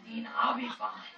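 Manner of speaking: weak whisper. Retold or imitated. Sick engineer waving off help.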